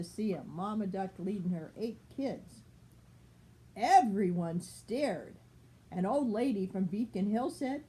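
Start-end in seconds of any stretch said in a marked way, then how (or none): none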